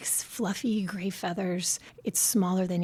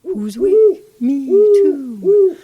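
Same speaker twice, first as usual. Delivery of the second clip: much higher and much louder hooting owl call; 10 semitones higher, 13 dB louder, much slower than usual. Retold or imitated. Host showing listeners the owl's call, loud high hoot. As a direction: belt it out like a great horned owl. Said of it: "With a deep hoot".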